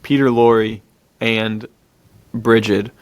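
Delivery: ordinary talk, nothing marked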